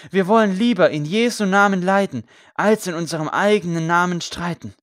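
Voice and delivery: loud, high proclamation to a crowd; impassioned preaching. A narrator's reading. Priest urges the crowd to forgive, loud impassioned preaching. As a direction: preach it out with full conviction.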